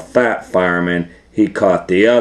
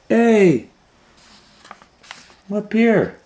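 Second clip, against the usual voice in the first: high, eager call from above; George calling down. Acted. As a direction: shout down happily to his friend. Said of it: "George was like".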